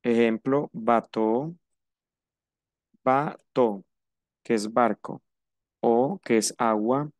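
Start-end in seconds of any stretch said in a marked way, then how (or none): 0.7-3.8 bateau said with a closed French o; bateau, final eau as a pure o. Shown as a demonstration. Teacher models bateau for Spanish speakers. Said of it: "También se pronuncia O".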